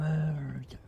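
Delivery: dramatic hushed whisper; whispered murder. Acted. A gamer reacting to the broadcast, mock horrified whisper. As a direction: gasp then whisper it in mock horror.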